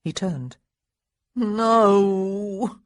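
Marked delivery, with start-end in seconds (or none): 1.3-2.8 loud, long moan of protest. A narrator's reading. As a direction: moan it out in dismay. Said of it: "Daniel moaned".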